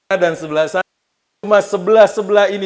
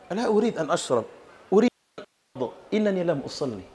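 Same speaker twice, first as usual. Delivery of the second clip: quiet dying words; 2 semitones higher, 7 dB quieter than usual. Acted. Dying Umar speaks softly.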